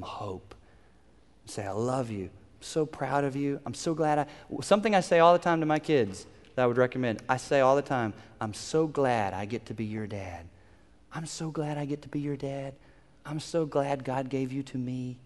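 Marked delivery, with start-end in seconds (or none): 1.7-4.3 soft, gentle parental affection; tender reassurance. Acted. Parent holding child after spanking, tender reassurance. 8.4-15.2 hushed, soft and sincere; tender fatherly affection. Acted. Dad to child after discipline, tender fatherly affection. Dad's affectionate words to his kids.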